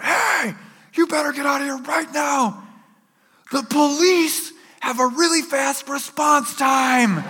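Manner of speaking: yelled threat. Retold or imitated. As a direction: keep bellowing a warning at an intruder. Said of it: "I just yell out".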